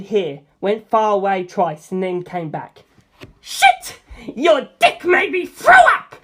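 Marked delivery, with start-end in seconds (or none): 3.4-6.2 high, loud, furious yell; a boy screaming after throwing up; Antonio yelling. A narrator's reading. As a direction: scream it out, disgusted and enraged. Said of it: "Antonio yelled".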